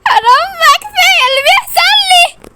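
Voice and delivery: high, loud wailing sobs; pretend crying. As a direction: cry out in make-believe grief. Girl wails as he's jailed.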